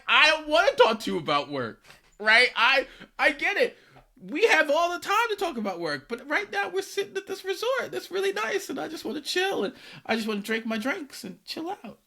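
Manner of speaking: high-pitched, strained retold protest; exasperated. Holidaymaker refusing work talk at a resort, exasperated. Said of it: "I was pissed".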